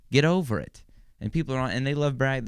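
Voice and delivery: normal talking voice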